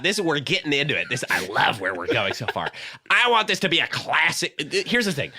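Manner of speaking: ordinary talk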